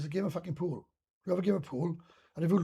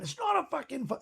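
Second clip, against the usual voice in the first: higher pitched indignant snap at the ref; retold angry protest; 9 semitones higher than usual. Teammate snapping at the referee Kieran, retold angry protest. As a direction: protest the call like a sore loser.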